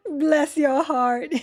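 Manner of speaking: hammed-up Southern lady through laughter; laughing Southern drawl. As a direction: tease it in a Southern belle accent, slowly. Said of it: "I'm really bad with accents".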